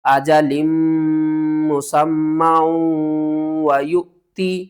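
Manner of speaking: very slow chant with ghunna; slow tilawat; Quran recitation. Shown as a demonstration. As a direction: recite it slowly with nasal ghunna. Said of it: "جھٹکے کے ساتھ پڑھیں گے".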